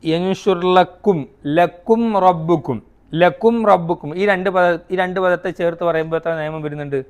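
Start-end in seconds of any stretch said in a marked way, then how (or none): 0.0-4.2 recited verse. Shown as a demonstration. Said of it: "ഇനി അടുത്ത നിയമം".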